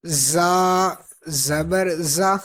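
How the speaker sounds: زا with a buzzing whistle; whistling Z. Shown as a demonstration. Teacher drilling sibilant letters, زا with a whistle. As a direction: sharpen the Z of زا into a whistle. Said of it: "اس میں سیٹی کی آواز آئے گی".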